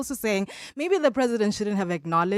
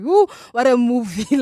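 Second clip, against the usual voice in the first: loud, delighted ooh; mock impressed audience; amused audience; 2 semitones higher, 8 dB louder than usual. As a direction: gasp like a gripped moviegoer. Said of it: "They would just be like".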